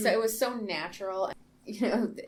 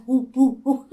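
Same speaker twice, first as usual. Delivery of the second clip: loud, higher-pitched whoops; excited whooping; 5 semitones higher, 9 dB louder, slower than usual. Retold or imitated. Viewer showing the cheer in their head, excited whooping. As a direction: whoop along like a fan.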